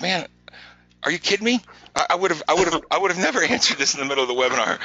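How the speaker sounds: high-pitched mock disbelief, laughing partway through; incredulous retelling. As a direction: exclaim it playfully, breaking into a laugh mid-line. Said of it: "I said".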